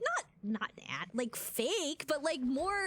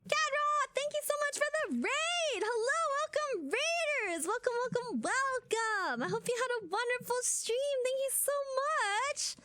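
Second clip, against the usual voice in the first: much higher, bright, sing-song welcome; welcoming raiders; 8 semitones higher than usual. Real, not acted. Streamer thanking Gadra for the raid, high and bright.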